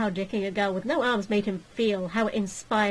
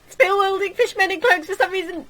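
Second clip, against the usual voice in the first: higher, louder panicked yell; panicked shout; 10 semitones higher, 7 dB louder than usual. Acted. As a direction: shout in rising disbelief. Alarm building.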